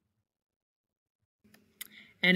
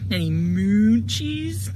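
slow, drawn-out cow asking; lightly voiced cow pleading; 6 dB louder, slower than usual. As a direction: stretch it like a lazy cow.

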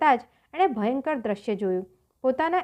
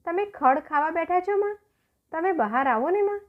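put-on raised, pleading little boy; child's voice; 6 semitones higher than usual. Little boy begging grandmother to come out, pleading child voice. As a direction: coax grandma like a small child.

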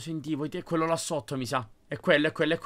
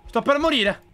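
rising panic; 9 semitones higher, 5 dB louder than usual. Real, not acted. Countdown panic in horror game.

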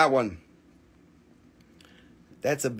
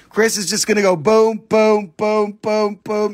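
hype; 4 semitones higher, 5 dB louder than usual. Pretend. Hyping buyer Chris.

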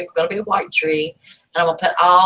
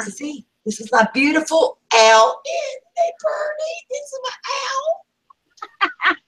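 hammed-up cooing over a pet, rising to a tiny squeak; playful showing off. Shopper showing her beautiful owl around the store, playfully cooing.

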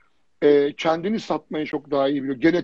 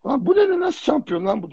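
shocked disbelief; 8 semitones higher than usual. Retold or imitated. Retelling his shocked reaction.

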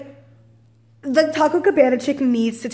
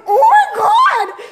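very high, drawn-out yell; hammed-up scream of exasperation; exasperated shout; 5 dB louder, much slower than usual. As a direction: stretch it slowly into a loud high cry. Acts exasperated reaction to wrong order.

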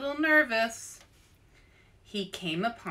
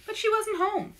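ordinary delivery

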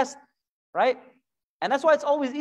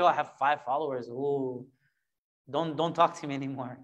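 lower, snooty mock brag; mock arrogance; 8 semitones lower, 4 dB quieter than usual. Preacher mocking a vain person with five followers, mock snooty. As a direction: play the puffed-up nobody, lightly.

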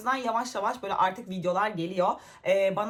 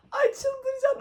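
exasperated squeal; slower than usual. Real, not acted. Laughing fit while filming.

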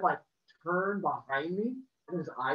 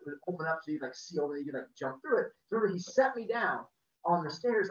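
normal talking voice

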